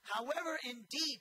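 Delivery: preacher stressing the emphatic words; emphatic however indeed. Shown as a demonstration. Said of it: "ke and de, those two words".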